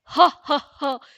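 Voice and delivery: loud, booming demon "ha ha ha"; terrifying ghostly laugh. A narrator's reading. Giant demon shaking Paranjothi, terrifying ghostly laugh. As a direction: laugh like a monstrous ghoul, first burst loud. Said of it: "பயங்கரமான பேய் குரலில் சிரித்துக்கொண்டு".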